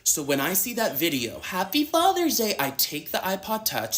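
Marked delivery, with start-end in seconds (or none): 1.4-2.6 put-on very high child's greeting; imitated young child. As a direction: mimic a little kid, pitched far up. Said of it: "a video of a mixed child saying".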